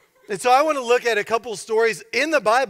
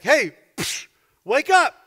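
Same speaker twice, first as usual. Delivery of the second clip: raised, higher jab on each phrase; mock confrontation; 7 semitones higher, 5 dB louder than usual. Thug trying to rouse the silent pastor, mock confrontation. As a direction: call out as if smacking someone awake.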